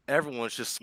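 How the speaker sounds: ordinary delivery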